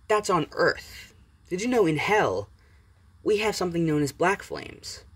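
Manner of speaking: gloating Izuku. Acted. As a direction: tease him that Hell's fire is worse.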